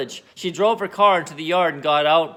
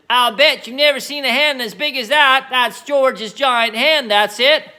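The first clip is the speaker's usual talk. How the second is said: Grandma shouting; 7 semitones higher, 6 dB louder than usual. Acted. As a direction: bellow it down, thrilled. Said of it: "Grandma shouted".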